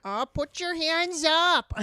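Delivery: slow, very high Minnesotan command; hammed-up Minnesotan, stretched and high; Fargo accent. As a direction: mimic the Fargo lady's arrest, loud and high. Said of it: "the lady's, like, arresting him".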